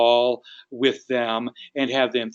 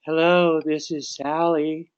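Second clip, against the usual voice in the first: unhurried, drawn-out phone greeting; 3 semitones higher, slower than usual. Acted. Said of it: "If it's a quiet person".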